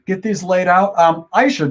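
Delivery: ordinary talk, nothing marked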